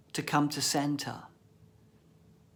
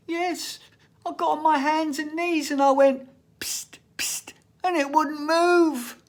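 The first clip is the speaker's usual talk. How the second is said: high, loud innocent schoolboy; little boy voice; 11 semitones higher, 6 dB louder than usual. Retold or imitated. Boy explaining the psst to his teacher, high and innocent. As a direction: explain it proudly like a little lad.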